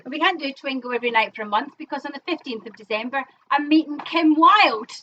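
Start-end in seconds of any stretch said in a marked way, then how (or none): 3.5-4.9 slow, loud, drawn-out boast; proud announcement. Real, not acted. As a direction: savour each word of the news. Announcing big news proudly.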